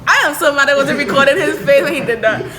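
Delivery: loud laughing rant. Real, not acted. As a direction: laugh while you talk. Laughing after friend's attempt.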